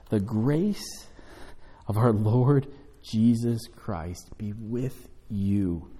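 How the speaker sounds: slow, measured benediction reading; scripture reading. Acted. Pastor reads the closing verse to the congregation, slow and measured. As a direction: read the blessing slowly over them.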